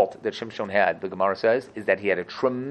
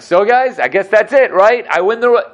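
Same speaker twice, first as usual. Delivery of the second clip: higher, louder, cocky gloating; smug Shimshon; 9 semitones higher, 8 dB louder than usual. Retold or imitated. Shimshon gloating to the Philistines, high and loud. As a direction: crow that you've won. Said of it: "he's like".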